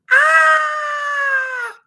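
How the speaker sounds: sudden high shrieking "Ah!"; kid's scream. Retold or imitated. Boy reenacting to mom how he screamed and ran. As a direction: scream as if scared of a face. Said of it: "Jamie, screaming?".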